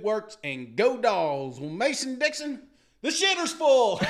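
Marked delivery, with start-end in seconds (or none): 3.0-4.0 a hammed-up Cousin Eddie shout, higher; Cousin Eddie line. Host answers the review with a mock Cousin Eddie yell. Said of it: "Clark Griswold, Mike, and Cousin Eddie".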